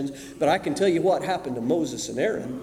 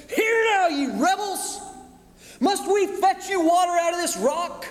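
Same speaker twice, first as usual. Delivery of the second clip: angry outburst; 10 semitones higher than usual. A narrator's reading. Moses shouting at the rebel people, furious. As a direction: lose your temper and yell it. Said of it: "he absolutely loses it".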